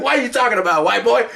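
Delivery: high, mock-scornful comeback; indignant challenge. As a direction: imitate a woman telling someone off. Women scornfully answer the officer back.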